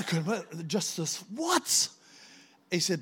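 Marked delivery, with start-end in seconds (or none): 1.3-1.9 indignant what. Retold or imitated. Retold indignant reaction.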